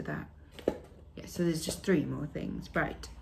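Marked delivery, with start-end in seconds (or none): none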